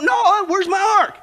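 high, slow call for Noah; shout. Acted. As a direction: shout across at the lazy builder. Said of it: "God shouted to Noah".

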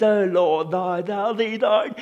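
hammed-up old-fashioned prayer voice; mock King James prayer; mock pious prayer. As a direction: lightly parody a stiff formal prayer. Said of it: "pray this way with the repetition of pretty phrases".

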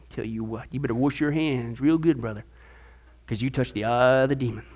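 lightly mock a finger-wagging church elder, low and hushed; legalistic church voice. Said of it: "They'll take one thing and they say".

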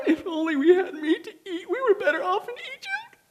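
high, soft whiny mock sob; hammed-up weepy whine about Egypt; mock wailing. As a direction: whimper it like a spoiled complainer. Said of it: "The Lord heard you when you wailed".

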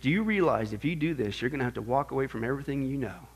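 low, soft tempter's voice; quietly warn, low and measured; hushed, low voice planting doubt; voice in the ear. Retold or imitated. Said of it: "this voice goes in my, uh, uh, ear".

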